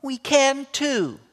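put-on high, slow, whiny little girl's insistence; little girl protesting. Rachel protesting to her brother, high and slow little girl voice. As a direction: stretch it out like a stubborn small girl.

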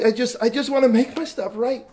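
high, loud, stammering plea; imitated crying. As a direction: beg urgently as a crying boy would. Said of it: "And he was crying".

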